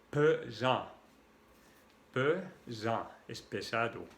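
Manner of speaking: ordinary talk, nothing marked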